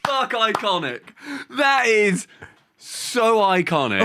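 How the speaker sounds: high, stretched, amazed praise; awed delight. Real, not acted. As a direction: savour every word of it. Awed reaction to the story.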